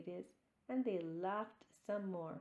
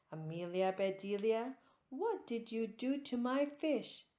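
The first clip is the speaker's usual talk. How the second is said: high, loud accusing shout; angry demand; 3 semitones higher, 7 dB louder than usual. A narrator's reading. Husband confronting Amelia Bedelia over his fish, angry shout. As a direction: demand an answer, furious. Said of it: "Mister Rogers was angry too".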